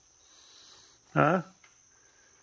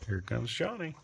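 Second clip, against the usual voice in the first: normal talking voice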